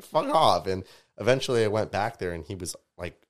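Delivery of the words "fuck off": loud, high retold brush-off; raised-voice mock dismissal; exasperated dismissal. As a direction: act out telling someone off. Retells telling off pushy artist.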